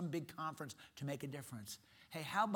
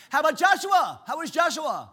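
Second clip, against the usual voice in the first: very high, loud, bursting with excitement; excitable Brother Vic; 11 semitones higher, 13 dB louder than usual. Retold or imitated. Imitating Brother Vic on the phone, high and loud. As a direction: boom it out like the most excitable guy alive. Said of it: "He said".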